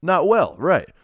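loud mocking echo to a friend; teasing echo. Real, not acted. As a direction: throw his words back at him with a grin.

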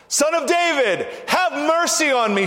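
high, insistent shouted plea; ringing high cry for mercy; shouting out. Acted. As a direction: shout it out, pleading to be heard over a crowd. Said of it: "He shouted all the more".

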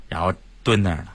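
ordinary delivery

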